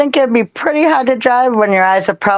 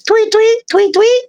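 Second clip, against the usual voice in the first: high, chirpy tweeting at the parakeets; high squeaky tweets for a parakeet; bird chirp imitation; 12 semitones higher, slower than usual. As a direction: chirp it like a little bird to the pets. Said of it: "I talk to 'em, I'm like".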